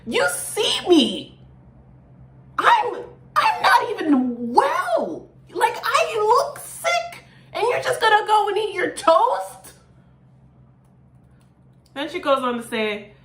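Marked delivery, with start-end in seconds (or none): 0.1-9.7 much higher, slowed-down incredulous plea, with a laugh; hammed-up indignant singer pleading with her partner; exasperated disbelief. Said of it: "She's basically saying".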